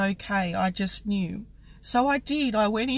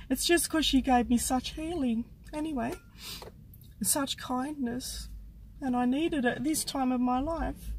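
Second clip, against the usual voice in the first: getting emotional; 4 semitones higher, 5 dB quieter than usual. Real, not acted. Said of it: "I'm getting emotional".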